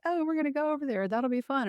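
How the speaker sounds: lightly mock the friends' breezy enthusiasm; eager friends. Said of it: "my friends were like".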